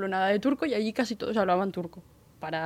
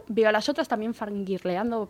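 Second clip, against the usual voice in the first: usual speaking voice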